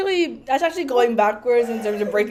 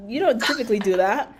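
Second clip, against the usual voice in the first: usual speaking voice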